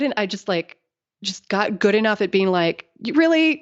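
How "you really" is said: incredulous. Retold or imitated. Calling out a sexist remark.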